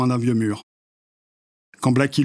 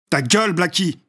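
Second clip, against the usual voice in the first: higher, harsh shut-up; angry snap; 6 semitones higher than usual. Acted. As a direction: cut him off hard. Reaper cuts off Blackie.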